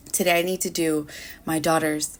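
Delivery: usual speaking voice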